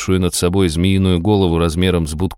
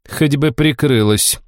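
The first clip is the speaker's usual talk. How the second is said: slow, reproachful tone; tut-tutting disapproval; disapproving; 6 semitones higher, slower than usual. Acted. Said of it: "с осуждением произнесла".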